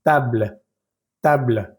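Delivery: "table" ending on the L, no e sounded; French "table" with a falling, clipped-off final e; silent final e. Shown as a demonstration. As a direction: stop "table" at the L with just a little puff of air. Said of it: "llegamos hasta la letra L".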